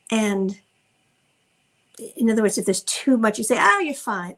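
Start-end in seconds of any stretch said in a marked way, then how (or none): 3.6-4.3 mock brushing-off, pitched up high; dismissive reassurance. Parent invalidating a child's hurt, dismissive. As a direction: imitate a parent waving away the hurt.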